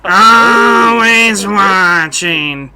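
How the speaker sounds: very slow, stretched deadpan Roz; Roz from Monsters, Inc. Retold or imitated. Host ends his Roz bit, very slow and drawn out. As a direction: drag every syllable out like a bored monster clerk. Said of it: "Roz ... from Monsters, Inc".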